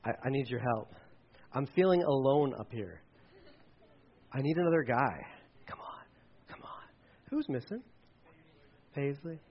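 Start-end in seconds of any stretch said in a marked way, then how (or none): 5.7-6.8 hushed, unvoiced urging; coaxing whisper. Real, not acted. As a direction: whisper it to coax a volunteer up. Coaxing another volunteer up on stage.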